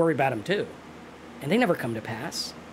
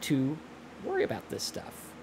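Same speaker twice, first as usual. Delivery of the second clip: soft reassurance; 2 semitones lower, 9 dB quieter than usual. Pretend. Soothing self-talk to calm worry.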